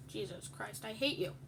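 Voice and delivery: ordinary talk, nothing marked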